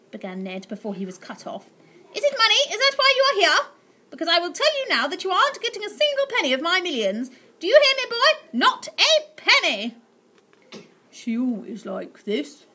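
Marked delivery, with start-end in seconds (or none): 2.1-10.0 very high, loud furious rant; ranting miser. Acted. Aunt Greta shrieking at the boy about money. 11.1-12.6 mild whisper. Acted. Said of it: "whispered Slime".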